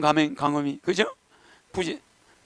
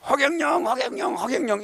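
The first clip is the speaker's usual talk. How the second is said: people calling out; 5 semitones higher than usual. Retold or imitated. Lecturer acting out people calling out for 허경영. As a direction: call the name like a crowd crying for him. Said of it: "이렇게 부르게 된다는 거야".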